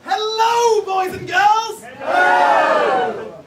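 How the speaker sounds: shouted high panto welcome. Acted. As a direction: bellow it to the back row. Panto host shouting greeting.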